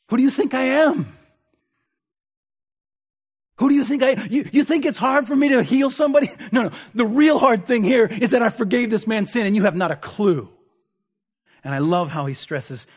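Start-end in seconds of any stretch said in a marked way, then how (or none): 0.1-10.5 higher, louder, challenging Jesus; Jesus confronting them. Acted. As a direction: demand it of the scribes, fast and pointed. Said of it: "Jesus is making the point".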